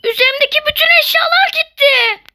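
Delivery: high, loud despairing wail; loud high lament over lost items; dismayed wail. Real, not acted. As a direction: cry out at losing everything. Lost all items on death.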